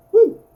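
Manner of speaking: loud high yelp at a flub; frustrated whoop. Real, not acted. Narrator yelping after stumbling over a word, loud and high. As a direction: let out a sudden high woo after tripping on a word. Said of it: "I am doing a lot of problems".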